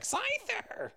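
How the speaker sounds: drawn-out high Pokemon cry; Pokemon impression. Host imitating how Scyther sounds in the show, Pokemon cry. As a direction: call it out like a cartoon creature. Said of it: "that's my Pokemon impression".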